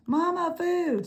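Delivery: very high, tiny chick calling for mama; peeping gosling. Acted. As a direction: peep like a baby bird crying for its mother. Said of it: "said the gosling".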